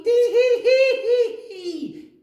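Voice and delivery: very high, loud, slow tee-hee giggle; monkey delighted with his trick; cheeky monkey giggle. Acted.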